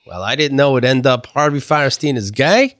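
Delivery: ordinary talk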